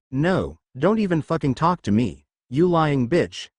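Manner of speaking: usual speaking voice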